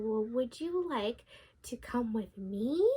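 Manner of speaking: slow scheming whisper; wolf's whisper. Acted. The wolf coaxing the girl to play, slow whisper. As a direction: lure her in with a slow whisper.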